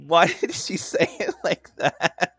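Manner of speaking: laughing. Real, not acted. Laughing about the line.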